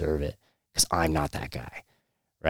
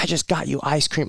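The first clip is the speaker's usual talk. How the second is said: retold yell; 9 semitones higher than usual. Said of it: "And I was yelling at him".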